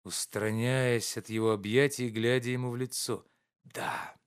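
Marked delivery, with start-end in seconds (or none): 3.6-4.2 a single whispered yes. A narrator's reading. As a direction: barely breathe the answer. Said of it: "прошептал Обломов". (шёпотом)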